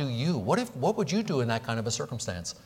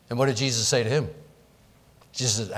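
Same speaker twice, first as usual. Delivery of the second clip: ordinary talk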